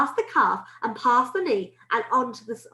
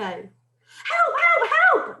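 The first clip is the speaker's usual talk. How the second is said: high, loud cries for help; screaming; 5 dB louder, much slower than usual. A narrator's reading. Cook with a mouse in his trousers, screaming. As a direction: scream for rescue, high and frantic. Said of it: "the man was screaming".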